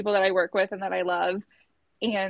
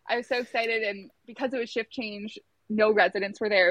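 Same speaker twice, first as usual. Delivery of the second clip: ordinary talk, nothing marked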